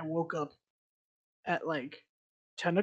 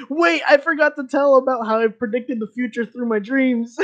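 sudden realization; 7 semitones higher, 11 dB louder than usual. Retold or imitated. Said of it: "I'm like".

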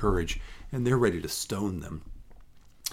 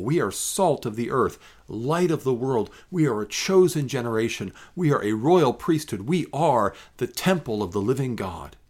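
normal talking voice

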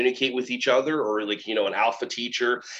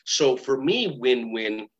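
normal talking voice

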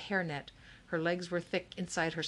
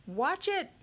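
high-pitched, urgent warning call; yelled warning; 11 semitones higher, 3 dB louder than usual. A narrator's reading. Boy warning as a statue topples, yelled warning. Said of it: "yelled C J".

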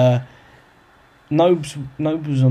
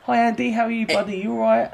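1 cheery celebrity greeting; 9 semitones higher than usual. Retold or imitated. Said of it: "and he's like".

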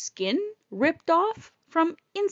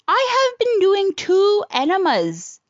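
raised, slow, emphatic protest; indignant retort; 6 semitones higher, 5 dB louder, slower than usual. Retold or imitated. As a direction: spell it out loudly and slowly, acting fed up. Indignant retort in retold quarrel.

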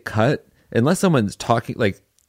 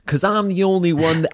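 much higher, mock imitation of a co-star's delivery; imitating Ruby's line; 9 semitones higher than usual. Director teasing her co-star by mimicking his line reading, pitched high. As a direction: parrot his exact intonation, pitched way up. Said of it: "your exact intonation when we write that line".

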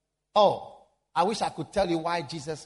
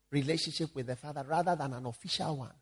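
ordinary talk

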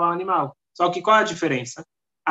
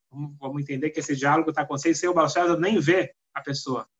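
usual speaking voice

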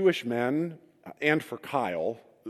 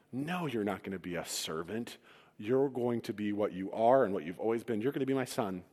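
tender father; 4 semitones lower, 7 dB quieter than usual. Acted. Father reassures returning son.

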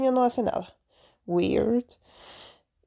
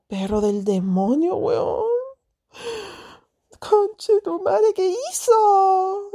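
higher, slow outraged cursing; angry cursing; 9 semitones higher, slower than usual. Retold or imitated. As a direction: curse the dog, drawn out and high. Cursing dog with bloody snout. (insulto furioso y lento al perro)

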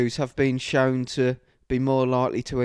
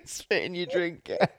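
ordinary delivery

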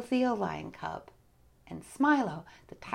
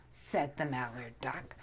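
plain reading voice

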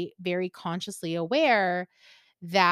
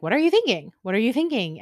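higher, louder repeated question; insistent prodding; 4 semitones higher, 6 dB louder, faster than usual. Retold or imitated. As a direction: imitate a mentor pressing for an answer. Mentor's repeated reminders, voiced insistently.

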